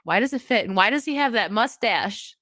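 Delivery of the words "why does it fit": usual speaking voice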